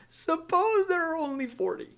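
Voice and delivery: very high, soft timid voice; Abraham. Acted. Abraham nervously bargaining with God, meek high-pitched request. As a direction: ask gingerly, high and small. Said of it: "I can only imagine how he's asking this question".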